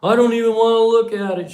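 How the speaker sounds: high, loud mock wail; upset daughter. Daughter refusing to see her birthday car, loud high protest. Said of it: "She was crying and carrying on".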